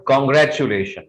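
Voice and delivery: congratulation said with a full "choo"; wrong pronunciation. Shown as a demonstration. Teacher rejecting the common form, mispronounced. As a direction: put "choo" before "lay" in congratulation. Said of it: "This is not".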